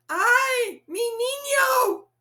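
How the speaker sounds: high, loud frightened cry; a mother's alarmed wail for her child. A narrator's reading. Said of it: "Mama cried".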